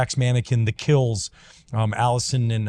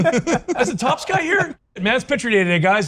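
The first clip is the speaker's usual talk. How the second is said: mock teasing; 12 semitones higher than usual. Teammates mocking his uniform as picture day, high sarcastic ribbing. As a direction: lay on the sarcasm, pitched way up.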